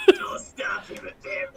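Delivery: guttural creature voice; disgusting, throaty character voice. Shown as a demonstration. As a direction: croak it out from the throat. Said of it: "guttural and disgusting".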